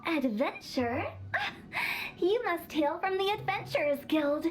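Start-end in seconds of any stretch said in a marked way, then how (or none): 1.1-2.1 airy high-pitched titter; a quick breathy delighted giggle; gleeful high giggle. Acted.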